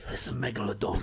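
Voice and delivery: hushed, intense film-star growl; Jason Statham. Acted. Imitating the trailer line for a friend, hushed tough-guy impression. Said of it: "only Jason Statham impression I can do".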